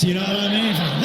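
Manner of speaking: normal talking voice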